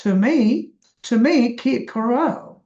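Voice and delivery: put-on slow English-accented "to me কি করো", stressing MI and RO; English accent, aspirated k's and long final RO; English accent in Bengali. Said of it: "রো জোরে বলবে".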